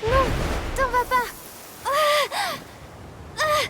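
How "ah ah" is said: pained cries. Acted. Child in pain, crying out.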